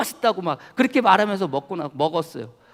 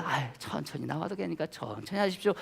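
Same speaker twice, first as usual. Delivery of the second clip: gentle reassurance; 5 semitones lower, 13 dB quieter than usual. Retold or imitated. Contrast: kind reply to waiter.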